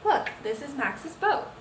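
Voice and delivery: ordinary delivery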